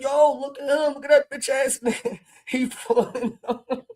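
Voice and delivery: high mock-gangster voice cracking into laughter; laughing gangster imitation. Streamer mocking a rapper's gangster talk, laughing imitation. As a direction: imitate him high, laughing through the words. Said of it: "Knowledge was like".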